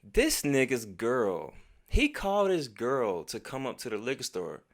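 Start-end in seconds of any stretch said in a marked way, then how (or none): none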